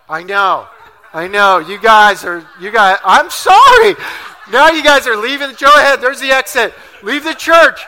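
loud, laughing, hammed-up apology to the church; mock apology. Real, not acted. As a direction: plead with the crowd, half laughing. Pastor mock-pleads with congregation.

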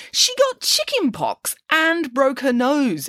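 high, drawn-out disbelief; slow, incredulous cry with pitch soaring; shocked exclamation. A narrator's reading. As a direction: exclaim it slowly in a high, astonished voice. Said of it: "exclaimed Nanny Piggins".

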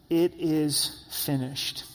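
very slow, every word drawn out; a slow, grave proclamation; slow solemn quote. Acted. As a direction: let it land slowly, word by word. Quoting Jesus' last cry.

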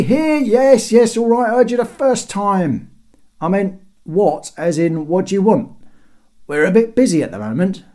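loud, high-pitched interruption; impatient guard. A narrator's reading. Busy guard answering the herald below, loudly impatient. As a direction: cut the herald off from the wall.

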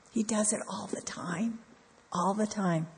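hushed whisper of awe. Real, not acted. Preacher whispers God's constant care to the congregation, hushed. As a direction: breathe it out, barely voiced.

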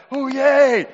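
high, loud hooray; loud pretend celebration; mock crowd cheer. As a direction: imitate an army cheering the plan. Army cheering the plan, acted.

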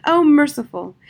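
loud, fervent exclamation; overwhelmed relief. A narrator's reading. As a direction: cry out in relief.

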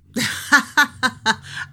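high, rippling laugh; real, spontaneous high laugh; burst of laughter. Real, not acted. Reacts to being asked if she remembers.

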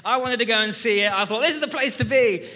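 loud, high burst of fan excitement; excited. Retold or imitated. Arsenal fan telling church his dream moment, loud excitement.

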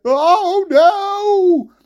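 high, loud cry of dismay; alarmed wail. Acted. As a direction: wail it as you start to topple. Giant on the falling beanstalk.

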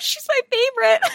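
high gushing squeal of love; gushing fan. Real, not acted. Fan gushing about favourite character, high squeal.

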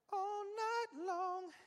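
high, loud and very slow, stretching every word; sultry drawn-out tease. Acted. Preacher hams up the seductress's invitation, loud, high and drawn out. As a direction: tease the listeners with a hammed-up sultry drawl.

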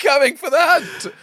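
high, astonished cry; hammed-up startled lookout; mock alarm. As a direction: sound the alarm in comic horror. Comic alarm at advancing dogs.

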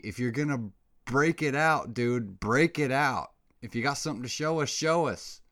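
fed-up call-out; demanding. Real, not acted. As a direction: challenge them to show it. Demanding aliens show up.

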